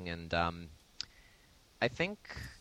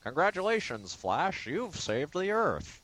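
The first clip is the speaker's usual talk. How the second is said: high, drawn-out robot voice; mock robot servant, pitched way up; 11 semitones higher than usual. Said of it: "literally this robot servant".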